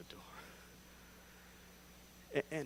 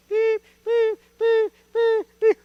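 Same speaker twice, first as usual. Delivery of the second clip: high, loud mock beeping; scanner beep; 15 dB louder, slower than usual. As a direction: chirp like a checkout scanner. Cashier ignores him, only scanning.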